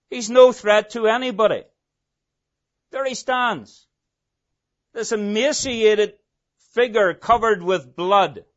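ordinary talk, nothing marked

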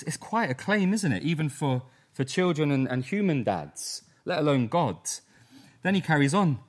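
usual speaking voice

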